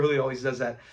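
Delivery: ordinary delivery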